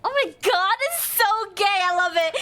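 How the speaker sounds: ordinary talk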